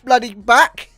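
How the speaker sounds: put-on retelling snapping at his girlfriend, loud; mock furious yell. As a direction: yell it like a betrayed customer. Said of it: "I was like".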